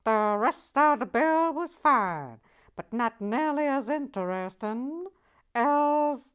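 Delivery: loud, high Boston Nancy voice, badly done; bad Boston accent. To viewers, reviewing the set as Boston Nancy in a loud bad Boston accent. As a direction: belt it out in a terrible Boston accent. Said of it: "I can't do a Boston accent".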